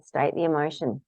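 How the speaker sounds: normal talking voice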